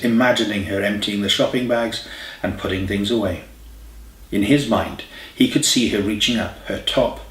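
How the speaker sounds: usual speaking voice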